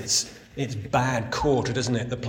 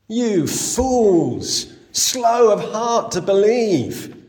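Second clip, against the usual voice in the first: loud, higher, slow rebuke; insulting rebuke; 8 semitones higher, 7 dB louder, much slower than usual. Acted. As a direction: scold them slowly. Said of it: "He insults them".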